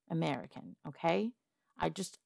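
ordinary delivery

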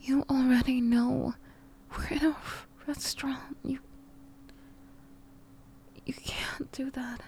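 whispering. Acted. Scolding quietly in restaurant.

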